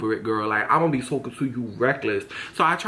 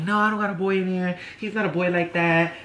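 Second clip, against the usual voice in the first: lightly put-on voice for a protesting man; mock denial; 3 semitones higher than usual. Man denying he has a boy there, lightly mimicked protest. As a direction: imitate his protest, pitched a little up.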